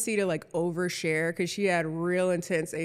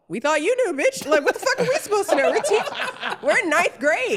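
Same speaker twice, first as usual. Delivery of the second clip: higher, fast, laughing comeback; laughing mock retort; 10 semitones higher, 4 dB louder than usual. Ninth graders answer the principal, fast and laughing. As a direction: toss it back at the principal, laughing. Students snapping back at principal.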